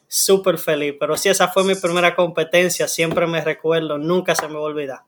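ordinary talk

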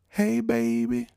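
very high-pitched granny voice; grandma voice. Retold or imitated. Grandma calling her grandchild up, high granny voice. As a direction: put on a high sing-out granny greeting. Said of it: "You know, granny".

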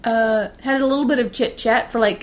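ordinary talk, nothing marked

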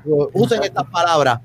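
usual speaking voice

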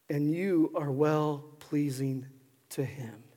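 hushed, deliberate sermon close; tender reassurance. Real, not acted. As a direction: let each assurance sink in gently. Tender closing assurance to congregation.

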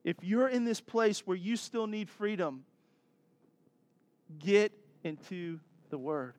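normal talking voice

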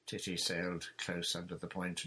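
ordinary delivery

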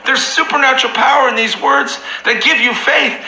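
high-pitched preaching at full force, pressing each line; fiery preaching. Real, not acted. Pastor preaching to his Bible study group, full force. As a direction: preach it from the soapbox, impassioned. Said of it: "I'm getting off my soapbox".

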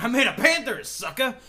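high, loud cocky brag; boastful shout. Retold or imitated. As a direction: crow it like a show-off champion. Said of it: "He was like".

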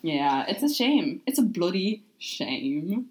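slow, drawn-out mock Australian-British accent; Australian-sounding accent. Shown as a demonstration. Host retrying an accent for friends, Australian-British mix. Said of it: "That's still kind of Australian".

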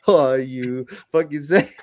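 slow teasing imitation of the dad, through laughter; mocking dad. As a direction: mimic the dad poking fun. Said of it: "he like".

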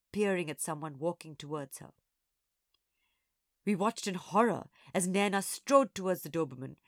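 plain reading voice

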